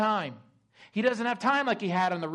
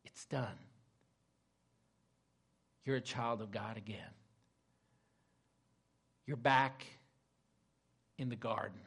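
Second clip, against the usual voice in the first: soft tender closing; 7 semitones lower, 8 dB quieter than usual. Real, not acted. Preacher's quiet closing reflection.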